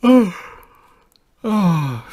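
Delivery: loud, high yawning "oh"; acted yawn. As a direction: demonstrate a big noisy yawn. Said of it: "quand on bâille". (bâillement sonore et joué)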